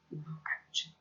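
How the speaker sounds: breathless whispered wince; a hushed sympathetic ouch; wincing. Acted. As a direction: suck air through your teeth in sympathy. Narrator winces at the kick.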